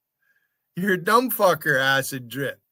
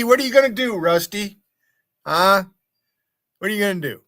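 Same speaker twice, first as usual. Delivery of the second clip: taunting; 6 semitones higher, 7 dB louder than usual. Real, not acted. Taunting a rival streamer.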